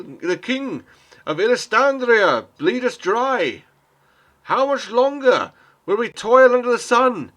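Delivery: high, loud, impassioned oration to the crowd; rabble-rousing shout. Acted. Man on a crate rousing the crowd against the king, shouting. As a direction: bellow it from the crate, desperate and furious. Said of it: "as he shouts out".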